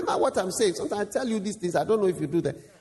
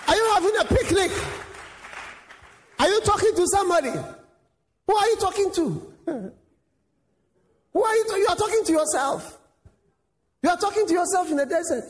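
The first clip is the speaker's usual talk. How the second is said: mocking villager; 9 semitones higher than usual. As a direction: jeer at him for talking to himself. Villagers mock Jesus in desert.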